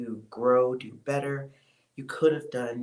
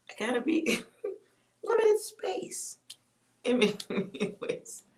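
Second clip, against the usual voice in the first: laughing aside; 10 semitones higher, slower than usual. Real, not acted. Laughs at own joke about age.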